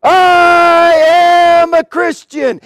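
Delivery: long drawn-out, high shout; acted martyr's defiant proclamation; bold declaration. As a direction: cry it out to a whole arena, stretching the words. Martyr repeats his cry to emperor.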